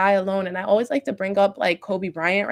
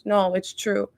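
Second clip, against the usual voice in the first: usual speaking voice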